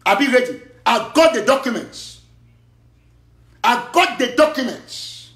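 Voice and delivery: defiant challenge. Real, not acted. Challenge to go to court.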